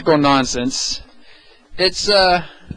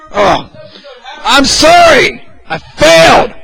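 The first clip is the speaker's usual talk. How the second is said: loud, high, slow mock wail; mock apology; 11 semitones higher, 6 dB louder, slower than usual. Podcast host catching himself breaking his no sob stories rule, mock wail. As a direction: ham up a theatrical apology. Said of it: "I said no sob stories".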